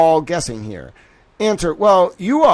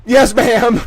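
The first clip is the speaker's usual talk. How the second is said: laughing yes ma'am; 7 semitones higher, 8 dB louder than usual. Real, not acted. Mock meek reply, loud.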